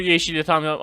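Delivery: usual speaking voice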